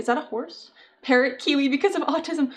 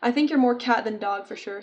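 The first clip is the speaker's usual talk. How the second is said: normal talking voice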